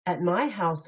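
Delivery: usual speaking voice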